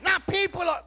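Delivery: loud, slow, sung-out preaching with rising pitch; full-force preaching. Acted. Preacher calling the church to rejoice, loud sung-out preaching. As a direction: lift the church with every phrase. Preacher climaxing on eraser image.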